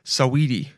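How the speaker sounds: "Saweetie" said suh-WEE-tee; name pronunciation. Shown as a demonstration. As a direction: say "Saweetie" in three syllables, middle one stressed. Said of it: "That's how you say it".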